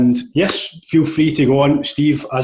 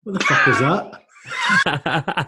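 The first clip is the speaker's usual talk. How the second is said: a slow, drawn-out disbelieving shout with a laugh; shocked laughing outburst; 3 semitones higher, much slower than usual. Real, not acted. Host sees a blue Guinness and shouts in laughing shock. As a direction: blurt it out in laughing disbelief at the drink.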